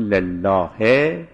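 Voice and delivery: لله slow, ending on a clear he; pause on the vowel. Shown as a demonstration. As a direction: draw لله out to its final e. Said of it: "این وقف به حرکت شد".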